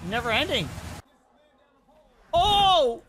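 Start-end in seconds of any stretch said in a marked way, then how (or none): 2.3-3.0 loud high startled oh; surprised shout. Real, not acted. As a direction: gasp it out in shock. Reacting to fail clip.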